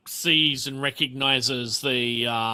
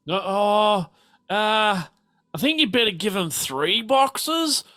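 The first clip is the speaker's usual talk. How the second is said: higher lilting lady's voice; character voice; 6 semitones higher than usual. Acted. Telling Reginald what to give, higher coaxing voice. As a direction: coax her husband in a higher voice. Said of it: "She's like".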